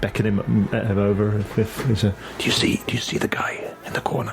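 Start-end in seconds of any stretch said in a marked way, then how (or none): 2.4-4.3 urgent hushed question; in-character whisper. Acted. Investigator asks partner about the figure, whispered. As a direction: whisper so only your partner hears.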